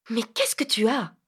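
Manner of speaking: whispered, suddenly worried; whispered question. Acted. Vinca to pale Phil, asking what's wrong in a whisper. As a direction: drop to a whisper, puzzled.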